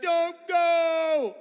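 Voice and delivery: loud, high, stretched-out wail; mock desperate plea. Friends begging him not to go, loud drawn-out mock plea.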